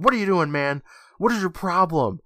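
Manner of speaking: lightly acted outraged yelling; retold yell. Colin confronts Felix after pushing him down, in a mild mock yell. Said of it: "And he's yelling".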